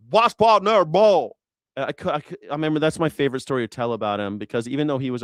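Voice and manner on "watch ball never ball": put-on ex-father-in-law's Virginia accent. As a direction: belt it out in a mangled Virginia twang. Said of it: "he used to talk like that".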